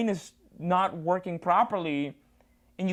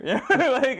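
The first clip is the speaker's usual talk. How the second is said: laughing; 6 semitones higher, 5 dB louder, slower than usual. Real, not acted. Laughs at own reply.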